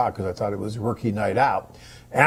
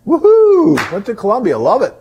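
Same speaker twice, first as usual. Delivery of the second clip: high, loud woohoo; excited cheer; 11 semitones higher, 9 dB louder than usual. Real, not acted. Cheering a viewer who loved Colombia, loud excited woohoo. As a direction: whoop it up for him.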